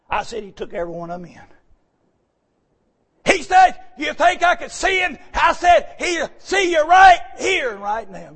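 high, loud preaching build; preaching at full force. Real, not acted. Preacher retells the park talk to his congregation, high and loud.

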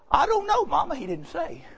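put-on high little-boy answer, trailing off softly. Young son answering his mama after church, little-boy voice. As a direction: start high like a small boy, then drop away. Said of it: "He was about six or seven years old".

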